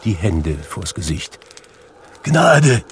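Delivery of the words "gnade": loud, high plea for mercy; terrified outcry; crying out. A narrator's reading. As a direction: cry out in dread, pitched well up. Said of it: "rief er".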